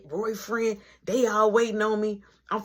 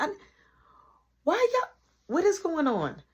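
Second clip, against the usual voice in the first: much higher, startled boss voice; a supervisor walking in on a fight; bursting-in manager; 9 semitones higher than usual. Retold or imitated.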